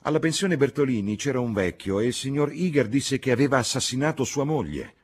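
high, loud, emphatic denunciation; indignant young Lucy. A narrator's reading. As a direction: condemn him with rising heat.

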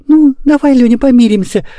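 put-on high little-girl voice, a touch shy. A narrator's reading. Girl making up with brother, high and mildly shy. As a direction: offer peace as a bashful little girl. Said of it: "застенчиво сказала Мурка".

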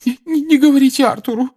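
very high, loud sobbing stammered plea. Acted. Mrs Weasley begging them not to tell Arthur, sobbing. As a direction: beg through sobs. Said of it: "схлипывала миссис Уизли".

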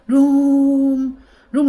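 word held long on one high level note; drawn out. Acted. Storyteller showing grandpa's endless shivering, long drawn out. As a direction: stretch it out to show endless trembling.

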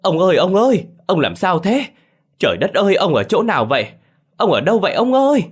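high, loud cries for a missing husband; acted wife crying out loudly in alarm; loud panicked calling. A narrator's reading. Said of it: "trong miệng la lớn".

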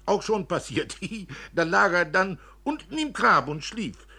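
höher und lauter, kichernd klatschend; über den trinkenden Mann lästern und lachen; schwatzende Filuccia. Acted. Filuccia klagt über Costanzo. (geschwätzige Alte, kichernd)

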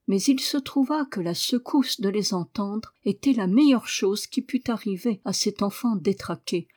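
ordinary delivery

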